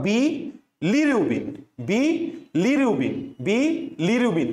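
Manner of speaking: "বি", clear pause, then "লিরুবিন" in one piece; chant-like drill, "বি" cut off from "লিরুবিন"; gap after first syllable. Shown as a demonstration. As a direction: break the word after "বি", before "লিরুবিন". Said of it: "তুমি একটু মাঝখানে gap দিবা".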